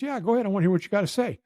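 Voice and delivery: high retold challenge; mad drill sergeant. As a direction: lightly imitate an irate Marine granting permission. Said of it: "So he wore me out for it".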